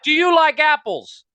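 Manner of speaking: hammed-up Good Will Hunting shout through the glass; mock shout. Retelling the bar scene to friend, shouting mock movie line. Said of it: "He's like".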